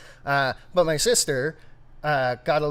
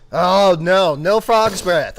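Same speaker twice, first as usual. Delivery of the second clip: loud protest; 7 semitones higher, 13 dB louder, slower than usual. Real, not acted. Rejecting whisky loudly.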